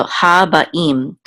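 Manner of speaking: ha ba'im said slowly in separate parts; slow, deliberate ha ba'im; syllable by syllable. Shown as a demonstration. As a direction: break ha ba'im into its pieces. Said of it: "The im part makes it plural".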